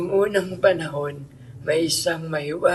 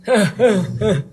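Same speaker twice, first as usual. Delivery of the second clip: grandfatherly laugh, short and louder; fond chuckle; 5 semitones higher, 6 dB louder than usual. Acted. Grandfather laughing at his grandchildren's joke.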